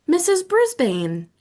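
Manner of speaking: shouted. Acted. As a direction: call out the answer. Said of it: "Small Paul shouted".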